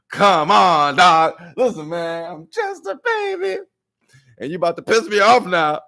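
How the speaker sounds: high, loud baby-voiced complaint; whiny baby. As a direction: whine then threaten. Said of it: "this is how I feel".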